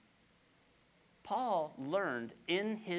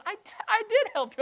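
higher, exasperated mock reply; mock God answering; 5 dB louder than usual. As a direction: answer back like an annoyed helper. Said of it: "And he goes".

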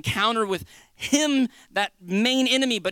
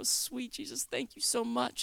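soft, higher-pitched thanks; hushed gratitude; 3 semitones higher, 10 dB quieter, slower than usual. Acted. Believer thanking Jesus for his love, hushed gratitude. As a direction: breathe a quiet prayer of thanks.

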